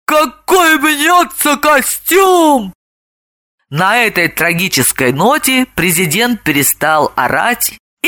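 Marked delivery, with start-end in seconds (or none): 0.1-2.7 long drawn-out anguished cry; slow, theatrical howl of grief; wailing yell. A narrator's reading. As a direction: wail it slowly, rising higher. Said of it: "он завопил".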